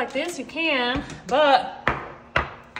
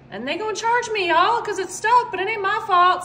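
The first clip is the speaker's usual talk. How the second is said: higher, louder complaint; indignant protest; 6 semitones higher, 4 dB louder than usual. Real, not acted. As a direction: protest the unfairness, pitched up. Complains about being wrongly charged.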